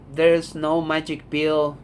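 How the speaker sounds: usual speaking voice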